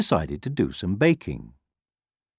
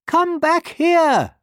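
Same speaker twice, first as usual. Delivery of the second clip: high, drawn-out holler; loud, high-pitched old woman calling; old woman's shout; 5 dB louder, much slower than usual. A narrator's reading. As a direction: shout slowly after a runaway. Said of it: "shouted the old woman".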